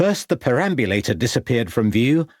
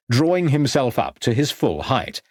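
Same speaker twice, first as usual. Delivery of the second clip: ordinary delivery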